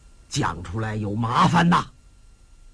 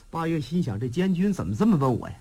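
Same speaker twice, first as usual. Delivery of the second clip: plain reading voice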